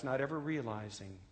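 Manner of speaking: usual speaking voice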